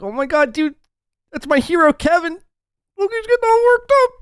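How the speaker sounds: very high, fast fanboy squeal; imitating Tom. S0 mocking Tom cheering Kevin, squealing high voice. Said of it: "He's like".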